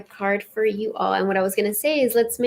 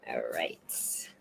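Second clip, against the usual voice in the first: soft breathy whisper to herself; hushed whispered aside; 15 dB quieter than usual. Real, not acted. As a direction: murmur it under your breath. Quiet aside before next card.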